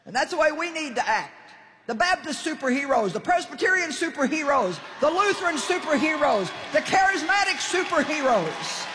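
high, insistent rallying cry; rousing preaching. Real, not acted. Preacher urging all denominations to fight together, rousing preaching. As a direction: hammer out each phrase like a rally speaker.

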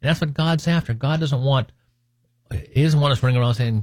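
normal talking voice

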